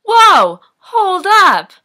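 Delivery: loud, high sudden interruption; mock alarmed halt. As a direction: shout to stop someone in their tracks.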